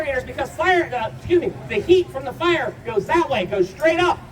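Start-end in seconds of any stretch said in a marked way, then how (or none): none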